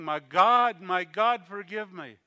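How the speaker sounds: loud, high anguished plea; mother crying out to God, desperate and loud. Retold or imitated. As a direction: cry out to God in remorse. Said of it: "why I was crying".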